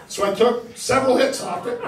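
ordinary talk

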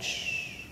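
soft, voiceless shush; hushing. Shown as a demonstration. Leader asking a child about being hushed, soft shush. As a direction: show how someone hushes a child. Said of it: "has anyone ever said".

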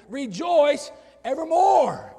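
slow, high, ringing shout; proclaiming scripture. Real, not acted. Preacher declaring the verse to church, loud proclamation. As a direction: declare it at full force, word by word.

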